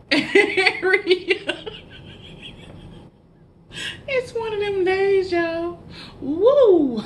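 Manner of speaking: high, loud cackling words ending in a whoop; helpless real laughter at your own slip-up; laughing hard. Real, not acted. Laughing fit over her slip-up.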